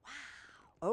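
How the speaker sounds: ordinary delivery, nothing marked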